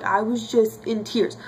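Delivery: ordinary talk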